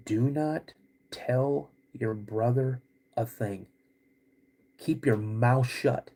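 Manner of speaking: each word weighted, no shouting; stern dad warning. Retold or imitated. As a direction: imitate a dad laying down the law. Dad sternly orders child's silence.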